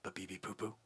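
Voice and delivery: confidential whisper; hushed secret. Acted. Salesman lets Joe Ellen in on a secret, whispering. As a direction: keep your voice down so nobody overhears. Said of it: "I have to keep my voice down".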